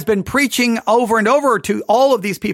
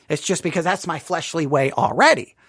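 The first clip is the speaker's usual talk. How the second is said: normal talking voice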